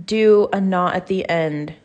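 ordinary delivery

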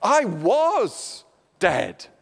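"I was dead" drawn out very slowly, each word weighted; solemn slow "I was dead"; slow stressed quote. Shown as a demonstration. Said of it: "we can think about the word was".

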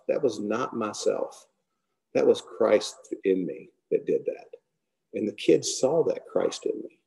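normal talking voice

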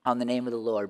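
ordinary talk

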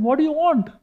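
high, insistent question; acted confrontation of a monster; retold challenge. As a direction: pitch the demand up high, volume held. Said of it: "Krishna asked him".

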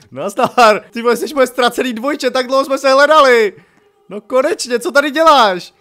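high, loud, gushing greeting; mock joyful reunion. Player meets a lookalike as lost twin, loudly overjoyed. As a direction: hail a long-lost twin with playful excitement.

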